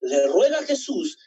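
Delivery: usual speaking voice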